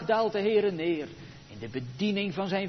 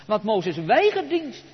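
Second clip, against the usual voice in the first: normal talking voice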